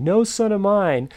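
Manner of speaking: high, loud, slow mock outrage; drawn-out playful father's proclamation; mock unaccepting father. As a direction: imitate dad hamming up his disapproval. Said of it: "acting like this, um, unaccepting father".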